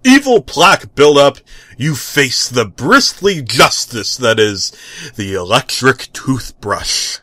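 loud, grand superhero proclamation; heroic declaiming. Acted. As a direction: declaim it like a battle cry. Hero declaiming at plaque.

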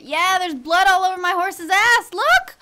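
loud, high, climbing cry of discovery; shocked exclamation. Real, not acted. Player spotting blood on her horse, shocked exclamation.

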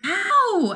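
high loud yelp; mock ow. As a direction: yelp at a second punch. Punched again.

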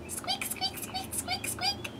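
high, soft squeaky mouse noises; mouse squeaks. Shown as a demonstration. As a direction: squeak like a tiny mouse for the kids. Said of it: "What sound does a mouse make?".

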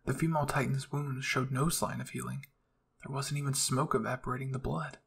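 usual speaking voice

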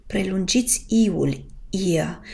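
ordinary delivery

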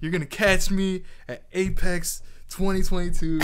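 loud, excited boast in imitation; imitating hyped excitement. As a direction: mimic a friend getting really hyped. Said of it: "he was really excited".

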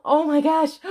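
high, loud burst of delight; thrilled gasp. Real, not acted. Mom unboxing her reborn doll, loud excited squeal. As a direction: squeal it out, unable to contain joy. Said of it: "all the gasping".

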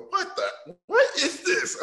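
a dad baffled by the drawings; laughing impression of a baffled dad; dad's disbelief. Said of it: "my dad would look at my drawings and go".

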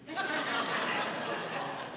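a real burst of high laughter. Real, not acted. Laughs at own joke.

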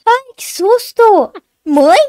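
loud, high cry of fright; startled. Acted. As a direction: cry out drawn-out, then call for mother. Reconhece a mãe após susto. (gritinho assustado, voz alta e aguda)

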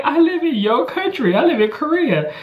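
high-pitched, exasperated mock plea; squealed, amused objection; retold protest. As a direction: insist as if it's obvious. Retold protest to teacher's advice.

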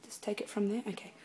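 whispered aside. Real, not acted. Singer whispering to herself between takes. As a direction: drop to a whisper off-mic. Quick aside mid take.

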